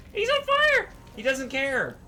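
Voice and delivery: high, loud exclamation; excited alarm. Real, not acted. Player reacting to a burning enemy, excited alarm. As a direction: blurt it out high and startled.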